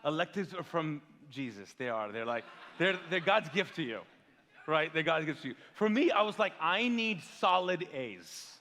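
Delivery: ordinary delivery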